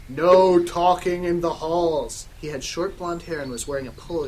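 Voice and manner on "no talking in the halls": loud, slow scolding; drawn-out principal's telling-off; angry. Acted. Dumbledore scolding students from his broomstick, angry. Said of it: "started shredding at us angrily".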